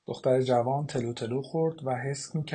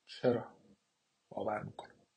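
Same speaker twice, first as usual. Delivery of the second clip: choked and softer, fading almost to nothing; muffled voice; 4 semitones lower, 7 dB quieter than usual. A narrator's reading. Daughter, reeling from the letter, speaks muffled and choked. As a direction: swallow the words as if the throat has closed. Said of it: "کیت با صدای خفه گفت".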